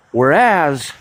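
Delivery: "whereas" drawn out with a full AZ ending; second syllable of "whereas" said like "as"; deliberate pronunciation. Shown as a demonstration. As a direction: stretch "whereas" slowly, clear short A in the second syllable. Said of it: "some would like to say".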